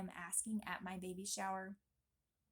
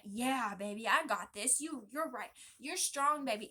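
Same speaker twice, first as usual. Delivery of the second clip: louder, bouncing reply to her husband; eager reply; 6 dB louder than usual. Acted. Wife answering her husband's pep talk, loud and eager. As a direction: answer him like a pumped-up athlete. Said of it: "acting her reply to husband".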